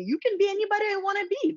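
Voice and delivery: high, quick parent voice; encouraging parent. Retold or imitated. Retelling what her parents told her, encouraging parent voice. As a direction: lift the pitch high and rattle off the encouragement.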